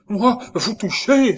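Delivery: high, loud, drawn-out recoil; horrified cry. A narrator's reading. Nurse refusing to touch the supposed ghost, horrified cry. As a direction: cry out slowly in horror, very high. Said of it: "s'écria-t-elle avec horreur".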